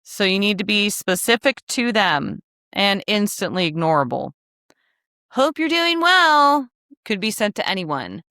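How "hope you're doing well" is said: high, drawn-out mock pleasantry; generic cheery greeting. Sender writing to just anyone, hammed-up generic cheer. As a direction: chirp it slowly like a stock email opener. Voicing a generic outreach message.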